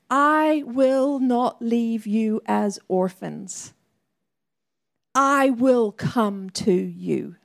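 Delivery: slow, weighty reading of Jesus' promise; solemn scripture quote. Shown as a demonstration. Preacher quoting Jesus to the church, slow and solemn. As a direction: let each word land, slowly. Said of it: "he says these words".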